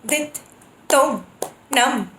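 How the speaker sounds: bols spaced out, then steady; slow then in tempo. Shown as a demonstration. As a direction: spell the bols slowly, then recite. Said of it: "For example".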